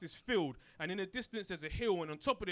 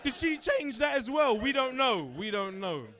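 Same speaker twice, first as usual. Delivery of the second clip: higher, louder worked-up questions; indignant rant; 7 semitones higher, 9 dB louder than usual. Retold or imitated. Kid raging about grandparents, loud outraged rant. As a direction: rant as if scandalised.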